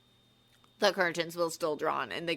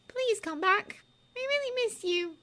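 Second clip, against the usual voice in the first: pleading. A narrator's reading. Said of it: "said Hermione quietly".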